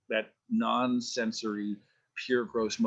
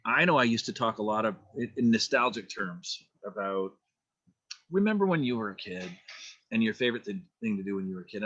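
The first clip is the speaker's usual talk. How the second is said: normal talking voice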